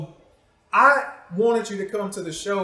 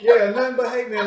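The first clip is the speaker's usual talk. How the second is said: louder, higher, laughing mock threat; rally the crowd against her, half joking; joking warning; 5 semitones higher, 9 dB louder than usual. Real, not acted. Host warning the audience to send hate mail if she ever leaves, loud laughing mock threat.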